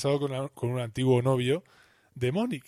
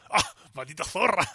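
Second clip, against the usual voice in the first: higher, louder outraged oath; higher, louder mock outrage; outraged curse; 5 semitones higher, 3 dB louder than usual. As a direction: spit the insult out in shock. Ross curses cousin Franny.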